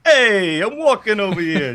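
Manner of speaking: a Jersey dad calling out at a family reunion; imitating a New Jersey dad. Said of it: "New Jersey dialect with my dad".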